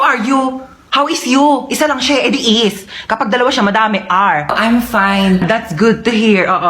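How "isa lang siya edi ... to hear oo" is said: ordinary talk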